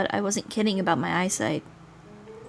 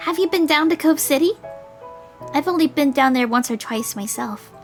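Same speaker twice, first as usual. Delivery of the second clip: put-on higher, lilting girl character; raised girlish reading; girl's voice; 5 semitones higher than usual. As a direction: pitch it up like a curious girl. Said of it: "I mix up the voice".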